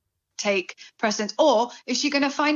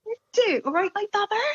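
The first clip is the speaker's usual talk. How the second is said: high put-on Bristolian greeting; Bristolian accent; 10 semitones higher than usual. Shown as a demonstration. Cohost asked for her Bristolian voice, strong Bristolian accent. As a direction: slip into a broad Bristolian voice. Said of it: "you have a Bristolian voice".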